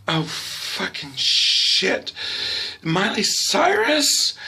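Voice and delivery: drawn-out, high, incredulous mom; mock appalled disbelief. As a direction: act out a mother's slow, rising disbelief. Mother reacts to "pan" in retelling.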